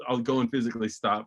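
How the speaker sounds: usual speaking voice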